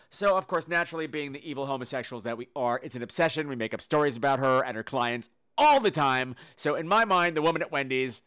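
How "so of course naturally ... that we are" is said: ordinary delivery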